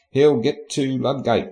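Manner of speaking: ordinary talk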